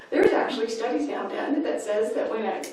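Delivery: ordinary talk, nothing marked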